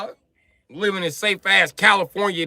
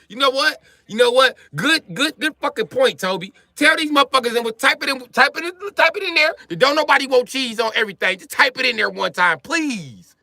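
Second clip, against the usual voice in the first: excited urging; 6 semitones higher than usual. Real, not acted. Urging audience to type it in.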